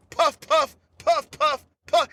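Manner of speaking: short breathy puff puff puff; puffing a blunt. Retold or imitated. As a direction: imitate quick drags on a joint. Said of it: "Snoop's like".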